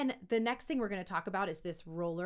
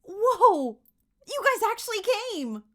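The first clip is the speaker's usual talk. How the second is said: very high, loud, laughing amazement; a kid thrilled to see friends; excited kid; 11 semitones higher, 11 dB louder than usual. Shown as a demonstration. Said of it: "see how I'm doing so many things".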